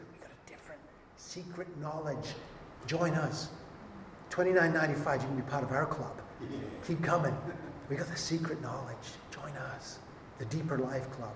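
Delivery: peddle secret knowledge like a cheap ad; mock sales pitch. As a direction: heretics selling a secret club, hushed and mocking. Mocking Gnostic sales pitch.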